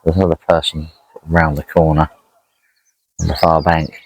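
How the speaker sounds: ordinary delivery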